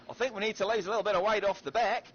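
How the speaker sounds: woman in advert. Retold or imitated. Gamer retelling a beer advert's punchline, imitated woman's voice. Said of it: "And the bird in the back, she's like".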